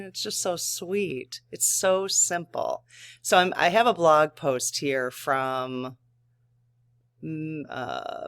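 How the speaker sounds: normal talking voice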